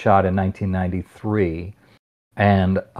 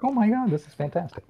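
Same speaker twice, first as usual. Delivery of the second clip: quick, high burst of delight; a rushed, high-pitched Oh my God; excited; 13 semitones higher, 6 dB quieter, faster than usual. Retold or imitated. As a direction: gush it out fast and high. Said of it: "I was like".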